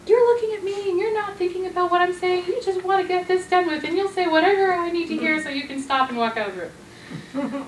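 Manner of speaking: a touch of blaming spouse; heated accusation. Retold or imitated. Partner mid-argument, lightly mock accusing. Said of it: "often we'll be in a heated situation".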